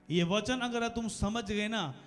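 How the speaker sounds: ordinary talk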